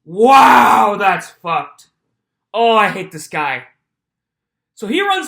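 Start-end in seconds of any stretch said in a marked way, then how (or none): 0.0-3.6 loud, disgusted outburst at the guy; outraged shout. Real, not acted. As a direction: blurt out real outrage. Outraged reaction to the lawsuit.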